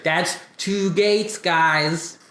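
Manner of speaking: slow, patronizing count to guys; slow explaining. Shown as a demonstration. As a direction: spell it out like to a child. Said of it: "So I had to show him today".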